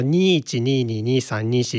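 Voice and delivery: lengthened "nii" then the bare digit, as in 二二 "nii-ni"; Okayama-style counting. Shown as a demonstration. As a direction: say 二一 as "nii-ichi", with no "jū". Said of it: "って数えてたんと思うんですけど".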